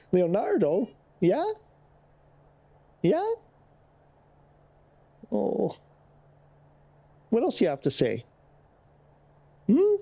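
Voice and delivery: pet talk. Real, not acted. Owner teasing his cat about a sculpture, high sing-song.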